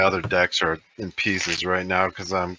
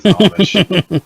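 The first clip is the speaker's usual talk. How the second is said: higher, louder, drawn-out punchline; joking; 9 semitones higher, 7 dB louder than usual. Real, not acted. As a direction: land the joke big and slow. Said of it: "I'm just joking".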